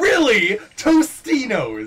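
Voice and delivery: loud, slow disbelieving Tostinos; incredulous. Real, not acted. Disbelief at wrong brand name.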